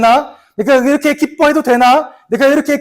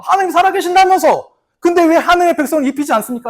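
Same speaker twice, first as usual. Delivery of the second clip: higher, fast, accusing rant; bitter complaint; 6 semitones higher, faster than usual. Retold or imitated. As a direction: fling the grievance at God. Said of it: "라고 막 자기가 그랬거든요". (어부 베드로가 하나님께 빠르고 높게 따지는 불평)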